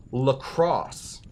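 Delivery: "lacrosse" slowly, ending in a sounded S; Wisconsin pronunciation. Shown as a demonstration. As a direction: say "lacrosse" like the sport, luh-KROSS. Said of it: "That's how the Wisconsin people say it".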